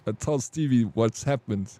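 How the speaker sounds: ordinary delivery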